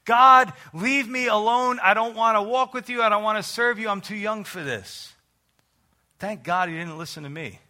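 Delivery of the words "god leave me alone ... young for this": re-enacted scream. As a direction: act out a drunken protest yelled in the street. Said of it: "I'm screaming in the street like this".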